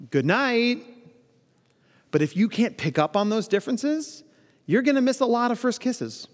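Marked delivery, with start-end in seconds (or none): 0.1-0.8 "good night" lifted much higher, cheerful and final; bright high good night. Shown as a demonstration. Date at the door gets a high, breezy "good night". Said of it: "big difference between".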